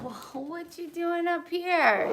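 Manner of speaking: high cooing voice for a pet; high pet talk. Real, not acted. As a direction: coo at the dog, slow and fond. Talking to the pet.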